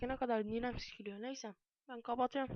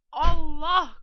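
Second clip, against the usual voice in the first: loud, higher startled exclamation; startled cry; 5 semitones higher, 16 dB louder than usual. Real, not acted. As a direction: cry out suddenly in alarm. Startled while using the computer. (irkilerek bağırma)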